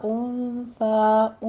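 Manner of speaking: انثى with a hidden nasal n and a long drawn final a; انثى stretched very slowly; drawn-out انثى with nasal ikhfa; tajweed pronunciation. Shown as a demonstration. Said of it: "اخفا کی کریں گے".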